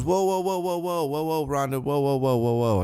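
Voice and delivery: high pleading stream of whoas; frantic whoa. Retold or imitated. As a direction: Kurt stops Ronda before the ring, high frantic pleading. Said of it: "Kurt comes out and goes".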